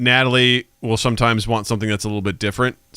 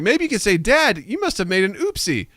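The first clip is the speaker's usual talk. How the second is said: much higher, gently coaxing; 10 semitones higher than usual. Retold or imitated. Mom softening things toward her daughter, sweetly. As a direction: coax it out sweetly. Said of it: "She's like".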